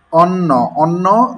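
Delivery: অন্ন with an open aw, slowly; read as written. Shown as a demonstration. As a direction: say অন্ন as a slow, deliberate "awnno". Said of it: "যেভাবে লেখা আছে ঠিক সেভাবে পড়লাম".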